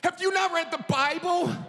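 exasperated yelling; indignant shout. Retold or imitated. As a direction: shout the question in disbelief. Acted quarrel, indignant quote.

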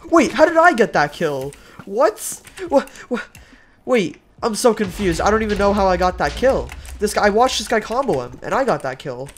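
loud, high confused exclaiming; baffled. Real, not acted. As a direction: shout in disbelief. Baffled by unexpected kill.

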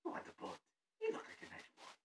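creepy, whispery evil clown voice; Pennywise. Acted. Performer rehearsing a horror clown for speech contest, creepy whispered coaxing. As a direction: lure them in with a creepy rasping whisper. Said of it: "I enjoy doing Pennywise".

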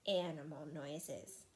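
ordinary delivery

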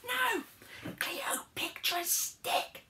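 slow, stretched hissing whisper; exasperated stage whisper. Acted. Harold correcting his mishearing friend, slow urgent whisper. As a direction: spell it out in a whisper.

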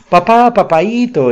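put-on high, loud, slow girlish cry of papa; joyful exclamation. A narrator's reading. Daughter bursting in calls her father, high joyful exclamation. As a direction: call out gladly as you burst in. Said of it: "exclamó su hija al entrar".

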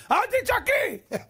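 high, drawn-out hammed-up screech; mock yelling. Husband screeching at his wife to stop shouting, mock yelling. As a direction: imitate yelling while telling her not to yell. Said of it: "אז למה אתה צועק?".

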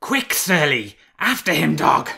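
loud, high command to a hound; shrill shout. A narrator's reading. Hunter urging his dog after the fox, shrill shout. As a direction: urge the dog on at full shout. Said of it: "Renard recognised this voice well".

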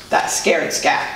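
plain reading voice

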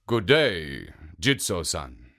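a big ogre being polite. Acted. Oni bowing and greeting the statue, low and polite.

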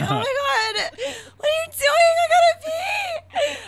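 high, loud, panicky squeal, half laughing; panicked squeal. Retold or imitated. Retelling to friends how she panicked, a high laughing squeal.

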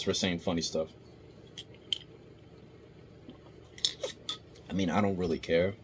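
talking into a bottle; words muffled by bottle; speaking with bottle at lips. Real, not acted. Seen 2: No snack or chewing seen; water bottle raised to mouth while speaking.

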